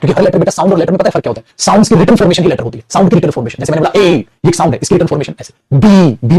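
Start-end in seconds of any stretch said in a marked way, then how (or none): none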